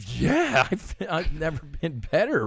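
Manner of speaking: high-pitched, giddy yes; retold elated reassurance. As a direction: answer high, as if it's obvious. Acting out his elated answer.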